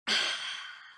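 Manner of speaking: long contented breath out, unvoiced; pleased sigh after a sip. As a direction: sigh as if the cocoa tastes great. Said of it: "Here we go".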